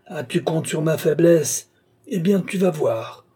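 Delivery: high, threatening outburst; enraged yelling. A narrator's reading. Scorned old man threatens his disciple, enraged yelling. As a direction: yell a threat at the scornful one. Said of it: "hurlait Altotas".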